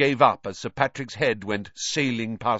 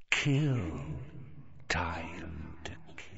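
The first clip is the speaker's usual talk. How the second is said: slow, much softer unvoiced hiss; cold monster whisper; 6 semitones lower, 8 dB quieter, much slower than usual. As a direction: whisper it like a hungry beast. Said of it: "the same cold, murderous voice".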